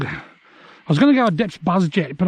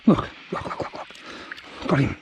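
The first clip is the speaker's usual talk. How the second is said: whispered, fading urgent repeats; hushed; 5 semitones lower, 9 dB quieter than usual. Real, not acted. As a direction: breathe it out under your breath. Hushed while landing the turtle.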